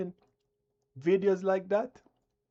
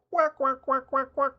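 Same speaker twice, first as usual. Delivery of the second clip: higher mock duck quacks; mock quacking; 4 semitones higher than usual. Mocking viewers with higher, playful duck quacks. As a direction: quack it out higher, poking fun. Imitating a bird.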